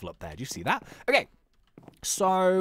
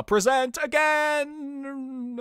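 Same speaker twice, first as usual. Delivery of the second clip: dramatic announcer; 7 semitones higher, much slower than usual. Pretend. Slow dramatic title read.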